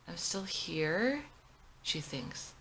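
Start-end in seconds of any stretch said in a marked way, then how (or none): 0.1-1.3 slow stunned thought. Acted.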